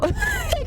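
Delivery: high-pitched burst of laughter. Real, not acted. Streamer cracking up at own Scottish accent, high laugh.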